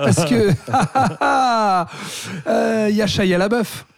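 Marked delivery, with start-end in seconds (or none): 0.6-2.4 long, exasperated-sounding burst of laughter; real drawn-out laugh. Real, not acted. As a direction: crack up before you can go on. Cracks up before naming Shia LaBeouf.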